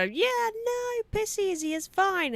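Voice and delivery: high, airy mock nonchalance; breezy dismissive. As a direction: wave it off lightly. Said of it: "you go".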